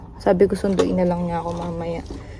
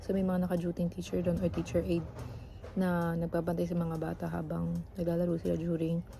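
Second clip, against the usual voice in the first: hushed vlogging; 14 dB quieter than usual. Real, not acted. Quiet vlog at school.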